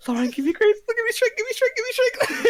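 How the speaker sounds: very high, pleading prayer; desperate prayer. Acted. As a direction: beg a goddess for strength. NPC prays for strength.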